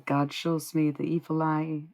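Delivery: ordinary talk, nothing marked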